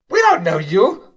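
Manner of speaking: high, squeaky wary kobold; suspicious kobold. Acted. Kobold cook confronting the newcomer, high and suspicious. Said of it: "one of the kobolds stops and goes".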